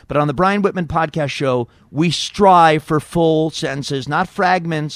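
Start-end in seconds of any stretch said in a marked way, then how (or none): none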